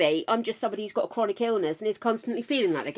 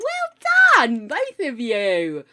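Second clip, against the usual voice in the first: overexcited praise; 5 dB louder, much slower than usual. Real, not acted. Congratulating both new mods, overexcited squeal. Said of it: "I get overexcited".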